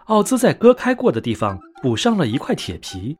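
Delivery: ordinary delivery, nothing marked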